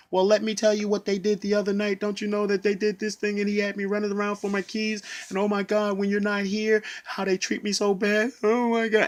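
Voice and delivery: high, loud tearful rush of words; retold crying plea. To the union rep, pouring out the abuse, crying. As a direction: pour it all out through tears. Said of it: "physically crying".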